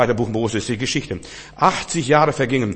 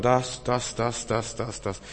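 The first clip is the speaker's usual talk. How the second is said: fading mock stammer; 2 semitones lower, 8 dB quieter, slower than usual. Mocking busy people's excuses.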